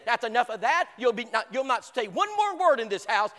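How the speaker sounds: loud angry outburst; furious shout. Retold or imitated. Angry father ordering the boy to stop, shouting. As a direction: slam the door on the conversation. Violent father slams the table.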